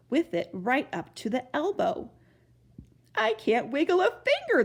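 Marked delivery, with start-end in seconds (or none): 3.1-4.6 high, whiny lament; wailing. A narrator's reading. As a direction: wail over the stuck hand. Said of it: "the trumpeter wailed".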